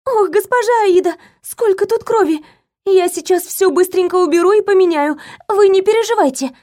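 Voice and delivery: rapid, high flustered chatter; too much caffeine. A narrator's reading. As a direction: gasp and rattle off words in alarm. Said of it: "затараторила".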